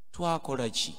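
ordinary talk, nothing marked

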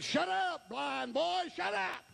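high, insistent acted rebuke; high mock yell; scolding crowd. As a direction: shut him up like a heckling crowd. Crowd silences the beggar.

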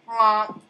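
a loud, flat honk mocking a villager; honk imitation. Teasing the villager about how it sounds, a mock honk. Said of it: "That's what you sound like".

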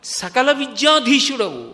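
saint crying out in rapture, voice lifting; overwhelmed with joy. Acted. Saint thanking Shiva for writing his hymns, ecstatic and overwhelmed. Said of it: "ఆనందం ఆగలేదు ఆయనకి, ఉద్వేగం పొందేశాడు".

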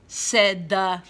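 normal talking voice

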